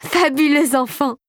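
high, drawn-out gushing praise; delighted exclamation. A narrator's reading. Fairy praises the girl who got the key, exclaiming. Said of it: "s'écria-t-elle".

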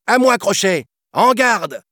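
put-on high, loud boy calling out; heroic challenge. A narrator's reading. Peter challenging the captain to a duel, loud. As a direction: fling the challenge across the deck. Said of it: "lança Peter".

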